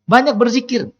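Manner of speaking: high, searching question; God questioning. Acted. God asking the angel about the man, high and questioning. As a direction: ask the angel sharply, pitched high. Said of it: "Kata Allah kepada malaikat".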